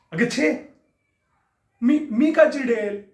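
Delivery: high, slow mock innocence; why would I be angry, sneering; sarcastic denial. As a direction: pretend you're not angry at all.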